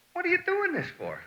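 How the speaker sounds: very high, squeaky retold question; high-voiced acted disbelief; incredulous question. Voices himself questioning frantic waiter.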